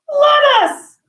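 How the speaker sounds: put-on very high child's exclamation; imitating a thrilled daughter; excited little kid. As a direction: squeal it like a delighted kid. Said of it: "she was so excited".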